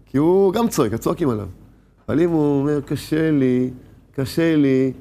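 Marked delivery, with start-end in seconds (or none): 2.8-4.9 pleading. Retold or imitated. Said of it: "מתחנן".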